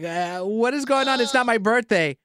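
exaggerated puzzled whine; mock bafflement. Mimicking the baffled wife.

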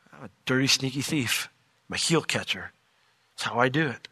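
low, soft admission; retold confession. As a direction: own up quietly, pitch dropped. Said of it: "Jacob admits".

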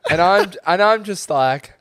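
ordinary delivery

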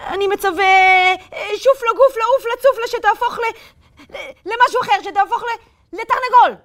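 nervously reciting the spell; slightly shaky voice. A narrator's reading. Itamar nervously casting the spell on the wolf. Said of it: "בקול קצת רועד".

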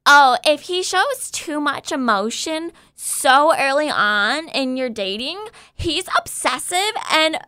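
mock an influencer's dating warning, leaning on the ending; mimicking influencers. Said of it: "another one that they're like".

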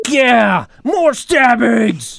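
loud, high metal-frontman yell with drawn-out words. Pretend. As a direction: roar it like a thrash band cheering on mayhem. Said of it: "Slayer would have been like".

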